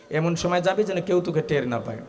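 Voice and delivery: ordinary talk, nothing marked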